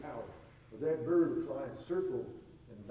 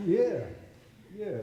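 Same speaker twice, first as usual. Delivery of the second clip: emphatic yeah; 6 semitones higher, 6 dB louder than usual. Real, not acted. Emphatic agreement, laughing point.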